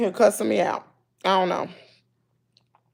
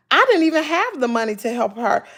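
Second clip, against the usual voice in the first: normal talking voice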